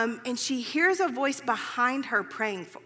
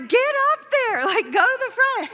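much higher and louder, pushing her forward; excited urging; 11 semitones higher, 8 dB louder than usual. Retold or imitated. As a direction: cheer her on to the front. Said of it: "And they're like".

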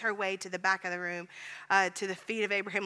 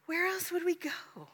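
whispered, higher, hushed repeat; whispered echo; 8 semitones higher, 3 dB quieter than usual. Acted. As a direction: breathe the question again.

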